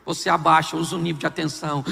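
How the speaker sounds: ordinary delivery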